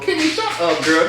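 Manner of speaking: high mock scold; exasperated scolding. Real, not acted. Vlogger yelling at Kimmy on TV, high exasperated snap. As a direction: tell her off in a squeaky voice. Scolding a crying cast member.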